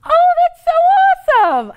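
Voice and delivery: very high, loud gush; mock-squeal with overdone delight; gushing mom. Other mom greets the introduction, hammed-up gushing. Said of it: "And the mom goes".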